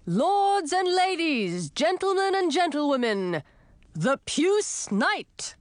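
high, drawn-out herald's proclamation; herald's shouted announcement. A narrator's reading. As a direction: announce slowly to the stands, stretching each phrase. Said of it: "then shouted".

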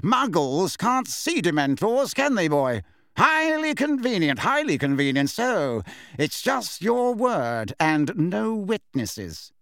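scornful official, drawn-out words; Fudge, pompous and dismissive. Acted. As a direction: scoff at the boy with pompous relish.

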